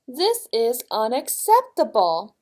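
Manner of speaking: loud, mildly voiced royal outrage; roaring king. A narrator's reading. King whose milk bath is spoiled, mildly roaring. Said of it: "roared a king".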